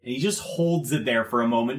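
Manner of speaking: ordinary talk, nothing marked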